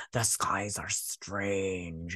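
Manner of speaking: low, very slow and drawn out; mock portentous. Elf remarking on the skies, mock portentous. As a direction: stretch it out softly like a hammed-up omen.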